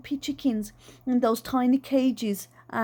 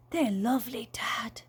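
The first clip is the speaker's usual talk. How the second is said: soft, half-whispered admiration; hushed little compliment; 4 dB quieter than usual. A narrator's reading. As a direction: let it fade into a whisper on the last word. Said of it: "she whispered".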